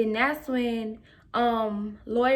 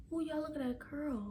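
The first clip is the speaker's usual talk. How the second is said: soft aside; 3 semitones higher, 14 dB quieter than usual. Real, not acted. Aside admiring curls.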